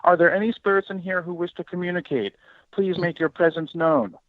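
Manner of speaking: stiff official ghost hunter. Retold or imitated. To the host, mocking newbies at an EVP session, stiff formal call. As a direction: ham up a stiff rookie investigator. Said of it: "they say this phrase verbatim".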